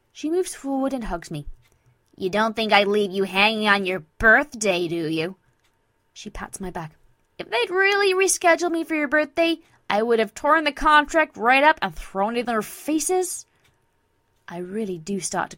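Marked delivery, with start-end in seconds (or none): 2.2-5.4 loud, cocky best-friend voice; brash Vinyl, teasing reassurance. Acted. 7.4-13.5 loud, high, bold friend's voice; brash Vinyl, defiant. Acted.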